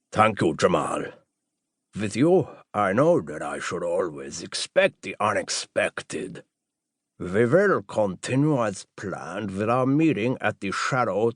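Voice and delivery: slow, deliberate doctor voice; doctor character. Acted. Doctor confirming the plan with Jamal, slow character voice. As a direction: draw out each phrase with measured courtesy.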